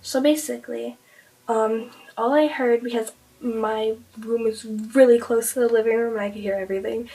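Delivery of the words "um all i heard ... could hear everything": ordinary delivery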